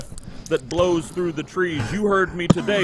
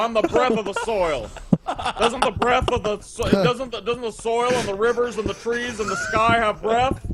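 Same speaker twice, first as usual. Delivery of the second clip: high, fast, giggly parody of a defiant crank; hammed-up defiant courtroom crank, breaking into laughs; mock sovereign citizen; 8 semitones higher, faster than usual. Host acts a sovereign citizen defying the judge, high and giggly.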